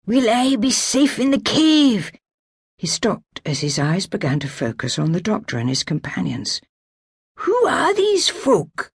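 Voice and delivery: wounded laird asking who the strangers are; feverish old laird. Acted. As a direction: drift between urgency and confusion. Colin's feeble high voice.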